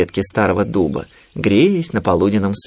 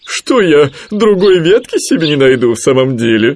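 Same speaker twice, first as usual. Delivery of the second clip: higher, louder character voice; suddenly carefree Owl; 6 semitones higher, 6 dB louder than usual. A narrator's reading. Owl deciding to find another branch, loud and carefree. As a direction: shrug it off loudly. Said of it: "внезапно сменила настроение сова".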